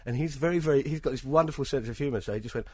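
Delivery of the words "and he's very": ordinary delivery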